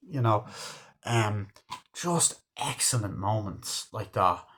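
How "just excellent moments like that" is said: normal talking voice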